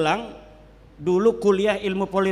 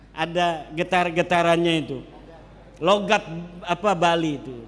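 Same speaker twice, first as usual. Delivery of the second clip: usual speaking voice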